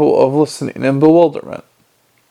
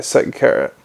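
usual speaking voice

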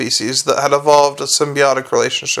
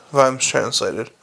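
ordinary delivery

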